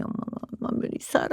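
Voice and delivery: imitated hushed little sadness; retold sad child. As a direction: confide it quietly, as a child to mum. Retold teased daughter confiding in mother.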